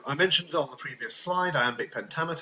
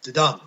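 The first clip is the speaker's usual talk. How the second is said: didum with a light di and a loud dum; iambic beat; 13 dB louder than usual. Shown as a demonstration. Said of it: "we have our iamb".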